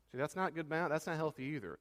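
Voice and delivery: ordinary talk, nothing marked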